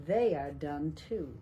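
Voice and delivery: ordinary delivery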